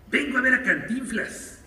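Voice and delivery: put-on a touch of a pushy young journalist; reporter's request. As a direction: lightly play the eager reporter at the door. Reporter asks at the door.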